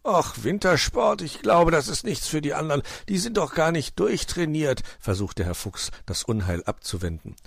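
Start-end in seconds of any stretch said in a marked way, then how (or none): 0.1-4.8 reluctant fox making objections; dismissive fox uncle. Acted. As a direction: talk them out of it, pitched up. Fox tries to avert trouble.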